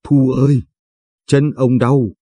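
understated groan of pain; faint moaning. A narrator's reading. Grandfather tells granddaughter his leg hurts, moaning faintly. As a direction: complain mildly, hurting. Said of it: "Ông Quý khẽ rên".

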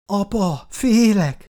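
put-on high, tearful cry of a scared little girl; frightened child's cry. A narrator's reading. Little girl cries out to her father, frightened. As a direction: cry out to Dad in fear. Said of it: "sírt fel Emma".

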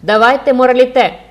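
loud, high shout; crowd clamour. A narrator's reading. The crowd clamours for the morality play, shouting. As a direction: echo the mob's demand at full voice. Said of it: "вторував натовп".

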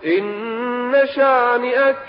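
slow, measured chant of the verse; Quranic recitation. Shown as a demonstration. Preacher reciting the surah to listeners, measured chant. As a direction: recite it slowly and melodically.

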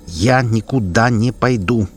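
slow, each syllable separate. Acted. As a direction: spell it out slowly, defiant. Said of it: "почти по слогам произносит". (по слогам, медленно)

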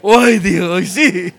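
playful gushing with a laugh; mock overexcited. Preacher teasing the congregation, playful gushing mock voice. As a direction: tease the neighbour as all worked up. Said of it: "usted es bien emocionado".

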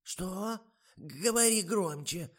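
высокий, громкий старушечий голос; переспрашивает, как глуховатая старушка; взволнованная старуха. A narrator's reading. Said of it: "заволновалась Ада Марковна". (высокий встревоженный старушечий голос)

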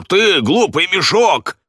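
high, drawn-out angry yell; panicked insult. Acted. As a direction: hurl the insult in a strained high voice. Редж бранит Дента в панике.

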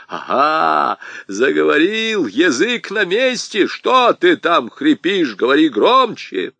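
high, loud, slow taunting yells; mocking shouts. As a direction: jeer and bark at him in triumph. Аргылов насмехается и кричит. (торжествующие насмешливые громкие окрики)

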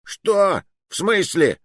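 high-pitched, indignant disbelief; offended exclamation. A narrator's reading. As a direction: react affronted, voice jumping up. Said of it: "обиженно воскликнул толстяк". (обиженно восклицая)

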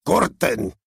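bellowed cut. Acted. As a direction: stop the scene with a yell. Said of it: "vociferó Harvey".

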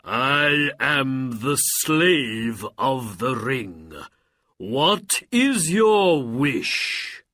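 slow, loud, drawn-out announcement; booming genie. A narrator's reading. Genie of the ring offering Aladdin a wish, booming. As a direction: intone it grandly, stretching the vowels. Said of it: "the genie cried".

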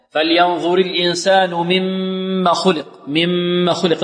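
short "ما" in "مما", no alif lengthening; حذف الألف. Shown as a demonstration. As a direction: recite with a short final vowel in "مما" after a held ghunnah. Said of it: "لابد أن نحذف الألف". (تلاوة "مما" بحذف الألف)

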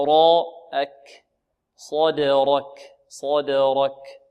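very slow صدرك; slow model. Shown as a demonstration. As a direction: stretch صدرك. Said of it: "هكذا".

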